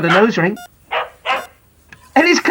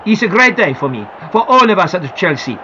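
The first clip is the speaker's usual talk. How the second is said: lightly voiced star player, lower; a hint of a proud footballer; footballer quoted; 4 semitones lower than usual. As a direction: read the star's words a touch lower. Reads Italian footballer's quote.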